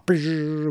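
rolled lip guitar sound; imitated guitar brrr. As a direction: buzz like a guitar riff.

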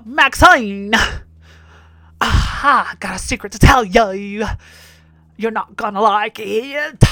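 loud, hammed-up bad country twang; mock a terrible country accent, stretching the endings; over-the-top fake country holler. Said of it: "trying to do a country accent, and it's so terrible".

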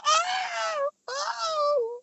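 shrill pained yelp, very high; high-pitched yelps. Retold or imitated. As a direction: squeal in sudden pain. Girl feels blades in wig.

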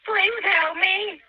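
very slow, drawn-out begging; desperate pleading. Real, not acted. Drowning caller begging the dispatcher, real despair. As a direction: beg with the last of your strength. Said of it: "I'm scared".

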